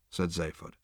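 ordinary delivery

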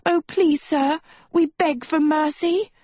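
high-pitched, melodramatic plea; pleading in a very high voice; begging. Acted. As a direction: beg for mercy theatrically. Sophie begs on her knees.

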